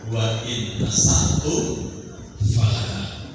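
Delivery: slow chanted Arabic verse; Quranic recitation. Acted. Preacher quoting scripture to the congregation, slow chanted recitation. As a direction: intone each word with drawn-out vowels.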